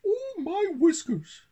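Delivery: very high, loud little-mouse squeal; Pippin crying out. A narrator's reading. Country mouse surprised by his visiting friend, high squeaky cry. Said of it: "cried Pippin".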